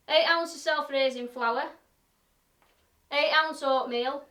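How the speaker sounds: a cheery broad Yorkshire demo voice. Acted. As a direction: play up the broad Yorkshire for the viewers. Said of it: "doing the demonstration ... in Broad Yorkshire".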